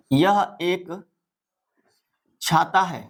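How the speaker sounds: broken into two pieces with a long pause; slow dictation. Shown as a demonstration. Teacher writing out an example for pupils, halting dictation.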